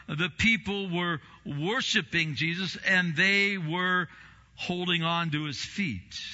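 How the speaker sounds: normal talking voice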